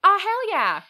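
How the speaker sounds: loud, high delighted cheer; excited. Real, not acted. Cheering a friend's promise of fun facts, loud and high. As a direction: shout it out with glee. Eager reply to history facts.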